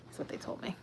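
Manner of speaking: soft whispered afterthought; whispered aside. Acted. Telling chat her height, in a whispered aside.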